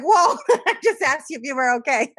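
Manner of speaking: startled and laughing; laughing surprise. Retold or imitated. Friend startled by the meltdown, laughing surprise. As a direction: laugh while backing off, surprised. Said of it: "that person's like".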